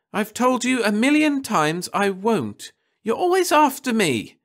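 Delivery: put-on high, loud teenage wail; wailing girl. A narrator's reading. Daughter wailing at her nagging mother, high and loud. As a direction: cry out that she's always picked on. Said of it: "she wailed".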